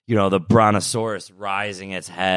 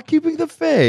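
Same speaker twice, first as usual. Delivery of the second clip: excited. Real, not acted. Delighted recognition of the film.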